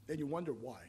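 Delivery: ordinary delivery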